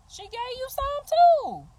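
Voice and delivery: high, taunting voice to the jealous women; high mocking squeak. Real, not acted.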